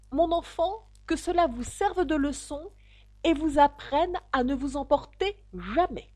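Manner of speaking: high-pitched, measured storybook lecture; moralising parent. A narrator's reading. Old crab lectures his child. (leçon de morale, voix aiguë)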